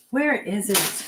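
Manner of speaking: ordinary delivery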